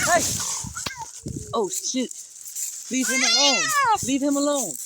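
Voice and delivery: very high loud cry; yelling in alarm to stop the fight. Real, not acted. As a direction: panic as the fight erupts. Cat fight escalates.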